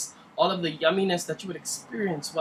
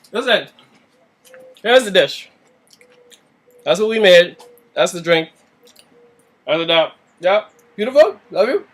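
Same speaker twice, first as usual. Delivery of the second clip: much louder, presenting proudly; loud show-off; 12 dB louder than usual. Real, not acted. Showing viewers the dish, drink and dog, loudly presenting. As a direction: announce it like a proud host.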